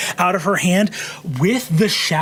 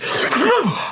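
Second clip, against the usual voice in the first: sharp, high acted gasp; sudden high intake of shock; startled gasp; 14 semitones higher than usual. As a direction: gasp as if caught off guard. Game master voices startled woman.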